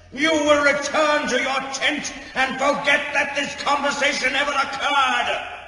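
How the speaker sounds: furious shout. Acted. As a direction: roar her down with the authority of an elder. Elder rebukes Signa for blasphemy.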